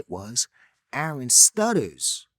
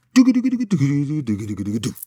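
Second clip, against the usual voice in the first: fast vocal bass line, dooga dooga; quick mouth-made bass groove; beatboxed bass riff; 4 dB louder, much faster than usual. Retold or imitated. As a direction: rattle off the riff like a drum machine.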